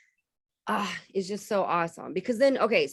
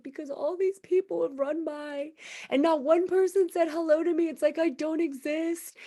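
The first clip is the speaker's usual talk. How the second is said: lightly voiced tearful girl, higher; a hint of a hurt, crying stranger; upset homeless girl; 7 semitones higher than usual. Host retelling a crying homeless girl, lightly voiced hurt. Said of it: "And she was like".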